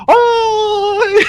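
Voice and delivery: very high strangled yelp; high hammed-up death cry; mock death cry. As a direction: squeal it like a dying NPC. NPC stabs himself.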